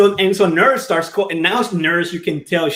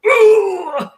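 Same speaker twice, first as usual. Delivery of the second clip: high, loud, drawn-out mock whoosh; a hammed-up breathing cue, much higher and louder; mock breathing coach; 14 semitones higher, 5 dB louder than usual. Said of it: "take a deep breath and go".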